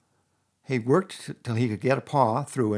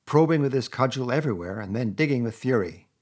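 ordinary delivery

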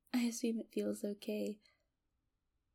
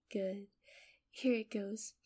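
ordinary talk, nothing marked

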